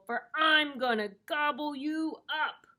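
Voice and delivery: high, loud troll threat; storybook troll. A narrator's reading. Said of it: "said the troll".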